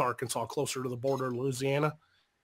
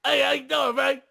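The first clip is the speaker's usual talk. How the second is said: much higher, loud hammed-up Cajun shout; loud, high mock Cajun backwoods accent; Farmer John impression; 11 semitones higher, 6 dB louder than usual. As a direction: bellow it like a swamp-country good old boy. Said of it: "sounded like that Farmer John guy from Waterboy".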